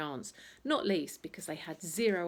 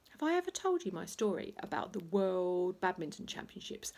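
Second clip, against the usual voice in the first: ordinary delivery, nothing marked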